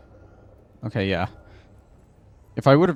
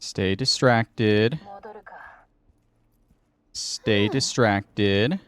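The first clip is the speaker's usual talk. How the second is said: slow coaxing; much slower than usual. Acted. Urging guard to stay distracted.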